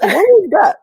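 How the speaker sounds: amazed reaction. Retold or imitated. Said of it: "they're like".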